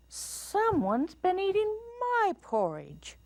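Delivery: very high, airy mama bear. A narrator's reading. Mama Bear finds her porridge eaten, high voice. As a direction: pitch it way up, stretching MY. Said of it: "said Mama Bear in her quiet voice".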